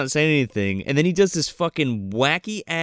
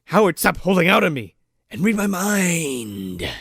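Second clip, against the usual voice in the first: Tim Robbins; 3 semitones higher than usual. Retold or imitated. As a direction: plead theatrically, stretching the last word. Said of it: "And he's just like".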